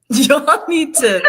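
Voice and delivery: loud, high, drawn out with laughter; cracking up. Retold or imitated. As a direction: burst out laughing while retelling it. Cracks up retelling her own outburst.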